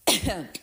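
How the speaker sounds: short congested cough; real cough. Real, not acted. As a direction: clear the throat with a cough. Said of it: "Sorry, I'm getting congested again".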